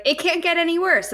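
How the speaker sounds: hammed-up character line; exasperated character. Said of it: "the character is like".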